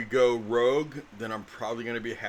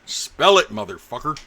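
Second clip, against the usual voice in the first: loud teasing; 2 semitones higher, 10 dB louder than usual. Real, not acted. Joking challenge to spell name.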